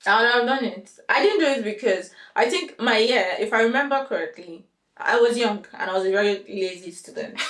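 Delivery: ordinary delivery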